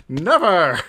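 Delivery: sudden loud playful shout. Acted. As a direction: yell it out in mock defiance. Joking refusal, laughing.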